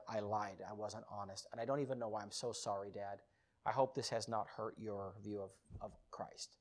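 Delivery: low, very soft admission; subdued apology. Retold or imitated. Son confessing a lie to his dad, subdued apology. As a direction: apologise quietly, almost fading away. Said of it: "and I said".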